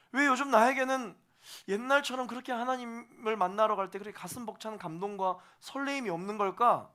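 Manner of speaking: put-on a young believer confused by God's change, anxious; bewildered. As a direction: plead in confused dismay. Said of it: "처음 겪으면 당황스러워요".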